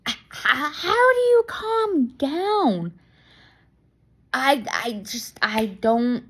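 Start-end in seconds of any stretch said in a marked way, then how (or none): 0.1-2.9 exasperated plea. Real, not acted. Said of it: "asks in exasperation".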